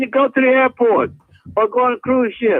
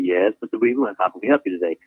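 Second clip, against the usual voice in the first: much lower, polite bank teller voice; 12 semitones lower than usual. Retold or imitated. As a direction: voice the polite teller low and calm. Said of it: "And they say".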